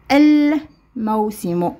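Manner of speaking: الموسم very slowly with a long L; stretched demonstration of the L in الموسم; sounded lam. Shown as a demonstration. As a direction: hold the L of الموسم before going on. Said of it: "الموسم هذه ال تنطق".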